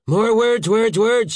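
loud, high, derisive repetition; scornful snort. A narrator's reading. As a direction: dismiss it with contempt. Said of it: "snorted Saint George".

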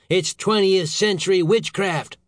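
high, slow, emphatic outrage; exasperated boss; angry howl. A narrator's reading. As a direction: spell out the absurdity angrily. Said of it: "the secretary howled".

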